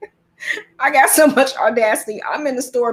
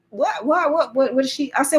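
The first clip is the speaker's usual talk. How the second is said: ordinary delivery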